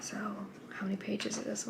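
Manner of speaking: soft unvoiced question to herself; whisper. Real, not acted. Reader counting the last pages late at night, whispered. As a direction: whisper it as if not to wake anyone.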